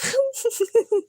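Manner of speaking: high trailing giggle; giggling. Real, not acted. Friend laughing about being sleepless and muddled, high giggle. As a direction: giggle at your own joke.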